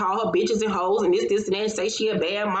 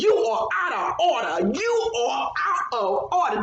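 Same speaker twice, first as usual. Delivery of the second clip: high, pitched-up mock shout of the angry judge; judge's angry shout; 5 semitones higher than usual. The judge scolding the husband, a mock high shout. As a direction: lightly shout it like a pissed-off judge.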